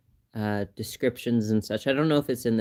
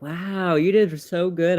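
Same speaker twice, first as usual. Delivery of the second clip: mock nurse praise; 6 semitones higher, 4 dB louder than usual. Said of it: "she was like".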